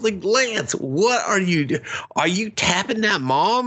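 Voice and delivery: high, incredulous exasperation; what are you doing, Lance, said high and incredulous; exasperated. Real, not acted. Friend ranting about a show character, high and incredulous. Speaker's own exasperated reaction.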